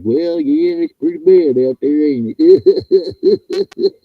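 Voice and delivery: high, loud, mock-clueless drawl, pitch leaping up at the end; mocking dumb listener. Host mocking complacent listeners, hammed-up clueless voice. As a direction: ham up a clueless airhead shrugging off doom. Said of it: "You're like".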